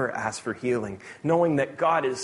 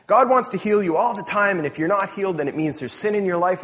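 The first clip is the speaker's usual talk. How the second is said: louder, raised-pitch preacher parody; mock hyper-faith evangelist; 6 semitones higher, 4 dB louder than usual. Pastor mocking evangelists, as a fiery preacher. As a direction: ham up a fiery faith healer. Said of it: "hyper-faith sort of evangelists that would say".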